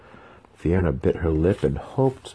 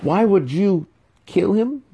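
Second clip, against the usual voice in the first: much higher, pleading; desperate question; 5 dB louder than usual. A narrator's reading. Trapped wizard asks the boy why, desperate and high. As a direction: cry out in disbelief.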